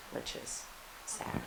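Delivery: soft, whispered mock sadness; mock sad. Real, not acted. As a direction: drop to a wry, breathy whisper. Jokes about sitting alone.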